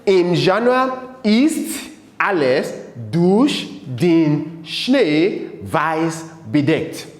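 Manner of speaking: slow, deliberate German, word by word; teacher's spaced-out recitation; slow model reading. Shown as a demonstration. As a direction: read the poem line slowly for learners to copy. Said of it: "let's start again from January".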